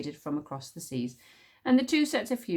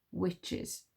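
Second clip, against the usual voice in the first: ordinary delivery, nothing marked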